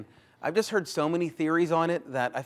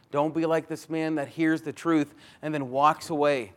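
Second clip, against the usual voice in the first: ordinary talk, nothing marked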